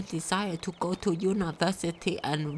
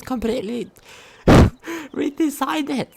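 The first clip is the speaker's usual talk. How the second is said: laughing; 5 semitones higher, 8 dB louder than usual. Real, not acted. Laughs while reading the diary.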